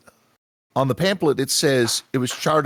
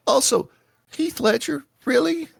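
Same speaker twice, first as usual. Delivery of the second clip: much higher, disbelieving rise; incredulous; 13 semitones higher than usual. Acted. Tourist doubting the guide's Heath Ledger claim, incredulous. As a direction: call out the absurd name in disbelief.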